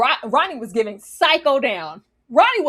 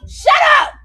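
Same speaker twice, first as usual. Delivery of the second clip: high loud shout; yelling; 8 dB louder than usual. Real, not acted. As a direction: yell it to silence them. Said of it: "I actively yell".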